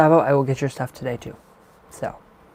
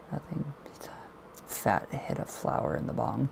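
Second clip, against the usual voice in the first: whisper; 8 dB quieter, slower than usual. Pretend. Joke confided in aside.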